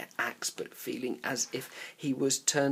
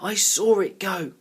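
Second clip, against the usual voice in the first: excited call; 8 semitones higher, 8 dB louder than usual. A narrator's reading. Excited chase, shouting.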